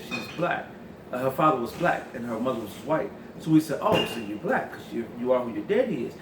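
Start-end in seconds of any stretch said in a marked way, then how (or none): none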